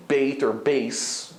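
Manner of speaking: "bait", "base" with a long ay; full ay glide in "bait" and "base"; long A sound. Shown as a demonstration. Teacher showing what Israelis don't say, long A. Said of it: "an A sound like we do".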